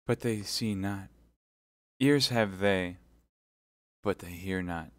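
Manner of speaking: measured mournful lament, fading at the end; deep grief. Acted. Milady lamenting blindness to Felton, grieving. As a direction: let the words sink in sorrow. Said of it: "with an accent of indescribable grief".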